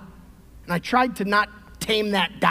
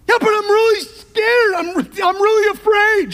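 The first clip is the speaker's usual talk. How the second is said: high, loud mock panic; hammed-up fearful reply; mock scared John; 12 semitones higher, 8 dB louder than usual. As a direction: play him lightly as a frightened disciple. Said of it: "Do not fear".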